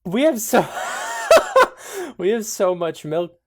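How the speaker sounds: words squeezed through laughter; trying to talk while cracking up; laughing. Real, not acted. Player laughing through a line about milk, laughing.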